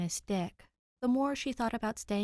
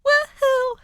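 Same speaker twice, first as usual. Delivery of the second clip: high, loud whoop of joy; excited cheer; 15 dB louder than usual. Real, not acted.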